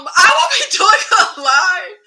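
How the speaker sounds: high, loud, talking through real laughter; helpless laugh breaking into the sentence; laughing through words. Real, not acted. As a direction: try to announce it while cracking up. Laughing at own mishap.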